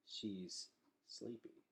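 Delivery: soft, slow hushed remark. Acted. Gregor telling the staring Underlanders, soft slow hush. Gregor hushing by sleeping Boots.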